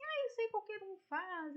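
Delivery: dismissive skeptic. Retold or imitated. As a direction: imitate someone brushing it off. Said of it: "tem gente que fala assim".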